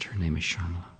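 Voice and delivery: ordinary talk, nothing marked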